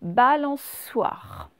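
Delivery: balançoire stretched out very slowly; slow dictation. Shown as a demonstration. Teacher reading the word slowly for pupils. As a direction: draw balançoire out syllable by syllable. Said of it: "qu'est-ce que vous avez entendu".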